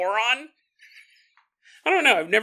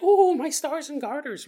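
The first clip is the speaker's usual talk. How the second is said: mock southern lady; 8 semitones higher than usual. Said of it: "And she's like".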